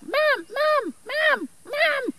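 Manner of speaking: very high, squeaky chick calling for mom; baby magpie call. Retold or imitated. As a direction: cry for mom like a hungry baby bird. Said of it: "Or something like".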